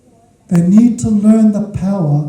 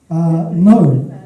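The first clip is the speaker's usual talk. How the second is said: usual speaking voice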